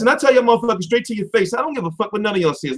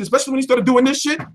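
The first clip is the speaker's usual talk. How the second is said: usual speaking voice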